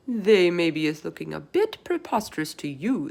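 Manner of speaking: big gentle giant voice, loud; BFG voice. A narrator's reading. Narrator voices the giant to Sophie. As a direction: voice the giant with wonder. BFG voice in read-aloud story.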